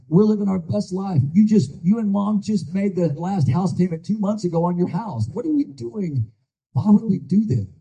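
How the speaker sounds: fighting back tears as he quotes her; choked up. Retold or imitated. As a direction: voice rising and cracking with emotion. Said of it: "with my choke in my voice".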